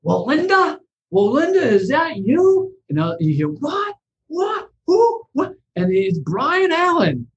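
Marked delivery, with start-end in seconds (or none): 0.0-2.7 mock call up the stairs, much higher and slow at the end; imitating his call. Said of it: "I heard him yell". 3.5-5.5 much higher squeaky startled what who; imitating a startled tenant, much higher; startled voices. Startled tenants answering in the dark.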